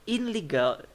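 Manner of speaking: "in legal" with a clear n; unassimilated IN LEGAL. Shown as a demonstration. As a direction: show the awkward n-l join in "in legal". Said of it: "that's way too hard to pronounce".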